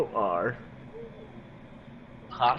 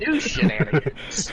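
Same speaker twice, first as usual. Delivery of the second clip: laughing; 8 semitones higher, 6 dB louder, slower than usual. Real, not acted. Laughing echo of GM.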